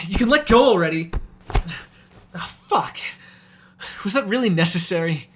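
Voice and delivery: pained, angry objection; indignant protest. Acted. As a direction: snap at the one who grabbed you. Protesting being hauled off prey.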